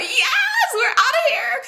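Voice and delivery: high, drawn-out squeal of joy; so happy. Retold or imitated. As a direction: cheer it out, overjoyed to leave. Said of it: "I'm so happy".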